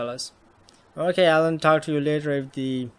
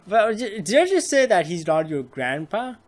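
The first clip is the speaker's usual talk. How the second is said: normal talking voice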